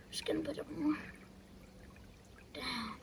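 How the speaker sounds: hushed whisper while working. Real, not acted. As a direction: keep it to a whisper. Quiet while placing net.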